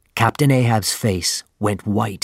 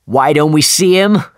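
louder, higher impatient call; urgent; 5 semitones higher, 5 dB louder than usual. A narrator's reading. Ahab to his crew, urgent louder call. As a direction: demand to know where the whale is. Captain urging after the whale.

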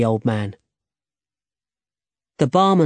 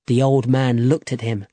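ordinary delivery, nothing marked

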